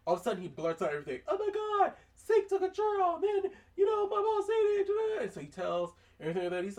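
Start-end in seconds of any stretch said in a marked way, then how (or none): 1.3-5.2 very high, loud frantic outburst; shrieking confession, much higher and louder; kid blurting out. Retold or imitated. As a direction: spill it all at once like a panicked kid. Eight-year-old blurts out everything.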